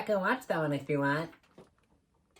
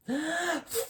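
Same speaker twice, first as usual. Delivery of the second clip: surprised gasp; 11 semitones higher, 4 dB quieter than usual. Acted. Puppet surprised book doesn't work.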